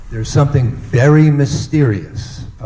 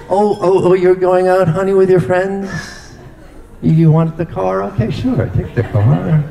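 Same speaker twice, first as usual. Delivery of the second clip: higher-pitched, overly eager fake approval; forced cheerfulness; 7 semitones higher than usual. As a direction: act like you're happy about it. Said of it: "pretend and act like you like them".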